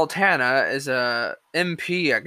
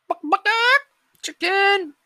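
much higher clucking; chicken clucking taunt; slower than usual. Pretend. Vlogger taunting critics as cowards, high chicken clucks.

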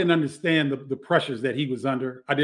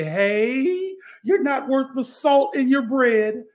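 high, raised Southern coach voice, slow and scolding; Southern twang; 9 semitones higher, 3 dB louder, slower than usual. Retold or imitated. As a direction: imitate an old Southern coach chewing out his player. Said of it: "he had a Southern twang".

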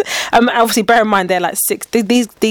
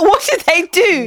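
baffled disbelief; 9 semitones higher, slower than usual. Retold or imitated. Retold baffled reaction to students.

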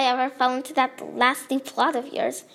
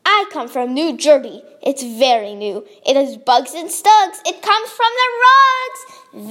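hammed-up New Jersey accent, growing louder; 2 semitones higher, 6 dB louder than usual. Shown as a demonstration. The narrator tries out an exaggerated New Jersey accent. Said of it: "let me try to do a New Jersey accent".

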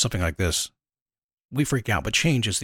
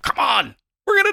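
shrill, much higher, louder panic cry; mock panic; 13 semitones higher, 4 dB louder than usual. Imitating a listener panicking about the crisis, hammed-up panic. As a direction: ham up a doomed wail. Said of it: "you say".

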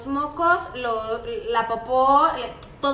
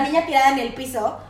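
ordinary talk, nothing marked

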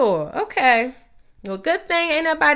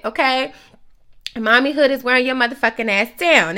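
ordinary talk

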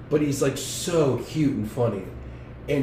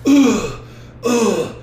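loud, exasperated mock groan; imitated huffy grunt, pitched up; Napoleon Dynamite; 8 semitones higher, 7 dB louder than usual. Mimicking an annoyed dater, loud exasperated groan. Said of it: "like Napoleon Dynamite".

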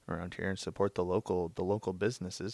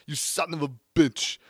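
put-on Austrian action-hero accent, higher and punchy; playful Austrian movie-star impression; Arnold impression; 6 semitones higher, 6 dB louder than usual. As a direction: growl it like a bad Schwarzenegger. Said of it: "gets me back into it".

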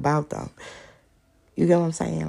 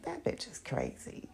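fond mutter; 6 semitones higher, 14 dB quieter than usual. Retold or imitated.

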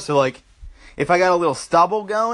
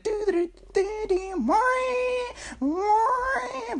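high, softer mouth-guitar wail; imitated guitar solo; 6 dB quieter, slower than usual. Guitarist playing his solo, high imitated guitar noises. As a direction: squeal like a whammy-bar guitar solo. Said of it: "he's sitting there playing the guitar".

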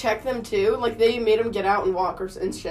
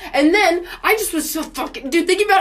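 getting mad; 7 semitones higher, 4 dB louder than usual. Real, not acted. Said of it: "I'm mad now".

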